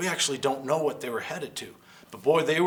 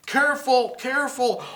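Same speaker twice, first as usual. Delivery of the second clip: urgent warning; 9 semitones higher than usual. Acted. Careful rescue.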